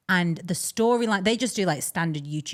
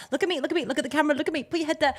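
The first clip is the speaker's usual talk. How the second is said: higher, hurried mimicry of a directing mom; bossy mom imitation; 10 semitones higher, much faster than usual. Host mocks mom directing her crying son, bossy imitation. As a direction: pile up confusing directions in a higher voice. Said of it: "her directions are so confusing".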